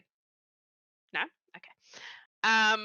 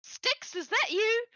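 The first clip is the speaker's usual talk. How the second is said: higher voice, asking in surprise; surprised Angeline; 13 semitones higher, much slower than usual. Acted. Angeline greeting the goblin at the door, surprised. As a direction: recognise him with a start. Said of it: "she's like".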